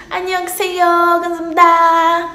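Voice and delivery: stretched greeting, then a quick sung thanks; slow mock Korean phrases. Shown as a demonstration. [name] greets a curious classmate in sing-song Korean. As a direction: show off fake Korean. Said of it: "Eu falo desse jeito".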